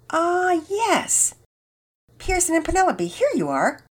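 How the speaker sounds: high, sing-song check-in voice; lightly voiced cheerful receptionist; camp registration lady. A narrator's reading. Camp woman checks list.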